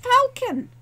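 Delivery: high loud startled cry of a name; shocked cry. A narrator's reading. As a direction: call out in sudden shock. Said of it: "He froze in shock".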